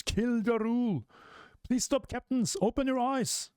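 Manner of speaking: high-pitched giant cries; high frantic giant voices; giants shouting. Acted. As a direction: yell it like a possessed giant pleading. Possessed giants screaming in manga.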